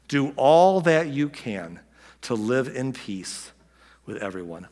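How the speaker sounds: slow, weighty reading of the verse. Shown as a demonstration. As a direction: lay each word down slowly. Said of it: "Paul closes by saying".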